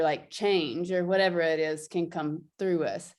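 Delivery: ordinary delivery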